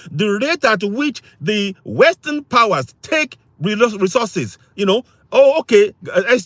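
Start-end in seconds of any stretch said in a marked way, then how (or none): 0.1-4.6 slow, deliberate words; said slowly. Shown as a demonstration. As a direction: spell it out slowly for emphasis. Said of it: "let me say that slowly".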